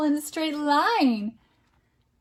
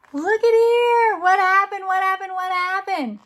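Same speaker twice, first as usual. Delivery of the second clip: high, loud pretend surprise; mock amazement; 6 semitones higher, 4 dB louder than usual. Parent reading an interactive book to child, amazed. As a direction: gasp at it like it's magic.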